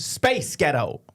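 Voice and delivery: slow, drawn-out American accent. Shown as a demonstration. Co-host demonstrating again for the host, slow American accent. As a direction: model it slowly in American. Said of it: "Go and do that again".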